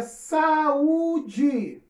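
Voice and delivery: saúde stretched out very slowly; slow word demo. Shown as a demonstration. Teacher presenting a hiatus word, very slowly. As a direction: draw out saúde for the class.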